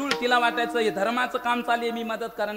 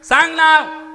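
slow then high loud demand; stern king demanding a name; stern command; 6 semitones higher, 9 dB louder, slower than usual. Acted. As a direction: insist on the name. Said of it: "म्हणली".

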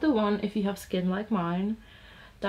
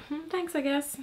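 awkward thanks; 6 semitones higher, 5 dB quieter than usual. Retold or imitated. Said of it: "I was like".

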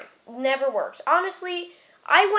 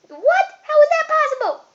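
very high, loud squeal of disbelief; mock astonishment; 7 dB louder than usual. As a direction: shriek it like a stunned listener. Plays the astonished viewer.

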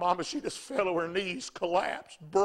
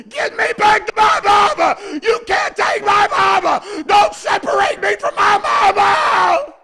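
very high, drawn-out desperate scream; full-force wailing yell, slow and piercing; yelling boy; 16 dB louder, slower than usual. Retold or imitated. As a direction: scream like a child being pulled from his mother. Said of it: "that boy was yelling".

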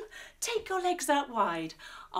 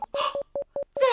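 surprised gasp; 13 semitones higher than usual. Acted. Spotting Snarge through cosminoculars.

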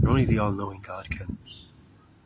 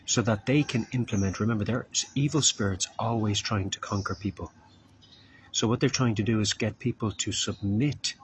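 ordinary delivery

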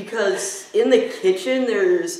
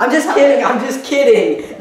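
laughing; 4 semitones higher, 6 dB louder than usual. Real, not acted. Confessing prank call.

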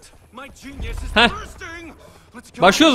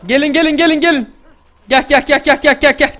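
calling out; 6 semitones higher, 6 dB louder than usual. Real, not acted. Calling players to come.

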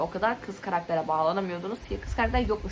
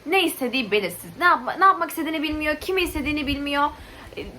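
higher, louder, worked-up complaining; exasperated rant; 6 semitones higher, 5 dB louder than usual. Real, not acted. Reviewer listing a male character's faults to viewers, exasperated. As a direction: rant as if barely keeping your cool. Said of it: "Sakin olmaya çalışıyorum".

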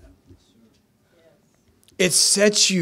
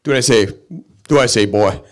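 put-on low, lower-pitched old-man order; gruff grandpa voice; 5 semitones lower, 4 dB louder than usual. Grandpa insisting to his grandson, low and gruff. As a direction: growl it like a stern grandfather.

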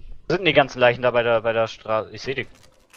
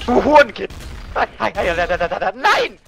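high furious shout; angry yell; 8 semitones higher, 4 dB louder, much slower than usual. Real, not acted. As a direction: scream at the traitor. Caught by the faker.

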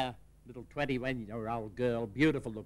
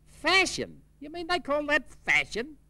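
much higher, louder shocked squawk; outraged disbelief; 13 semitones higher, 5 dB louder than usual. Acted. Auntie scoffing at the niece's bare fashion, high outraged squawk. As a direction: hit it as scandalised outrage.

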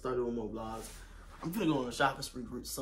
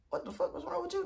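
mock yell. Confronting guy who approached.

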